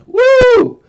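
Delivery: loud high whoop; excited whoop. Real, not acted. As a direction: cheer it out. Cheers at Bitcoin prediction.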